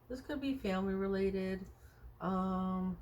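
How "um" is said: ordinary talk, nothing marked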